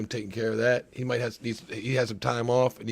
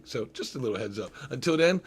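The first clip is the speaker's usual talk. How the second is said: ordinary talk, nothing marked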